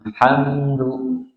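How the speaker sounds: slow, soft "Alhamdu" with tongue veering on l; bent tongue l. Shown as a demonstration. Teacher showing inhiraf, slow soft "Alhamdu". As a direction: draw "Alhamdu" out softly. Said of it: "cenderung membelok".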